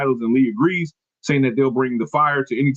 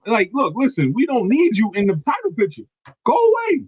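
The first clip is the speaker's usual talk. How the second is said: higher, rising to a shouted go away; mock dismissal; 10 semitones higher than usual. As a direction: shoo her out of the title picture. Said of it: "telling her to leave".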